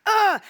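loud, high fed-up ugh; exasperated groan. Retold or imitated. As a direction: let out a frustrated ugh. Frustrated groan at wrappers.

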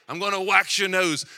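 hammed-up, badly faked South African accent. Hipster barber in Cape Town threatening a nose wax, bad South African accent. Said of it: "That's the worst South African accent".

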